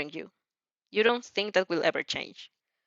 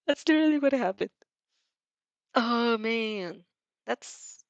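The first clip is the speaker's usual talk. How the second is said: laughing; 3 semitones higher than usual. Real, not acted. Reader laughing at recognised event.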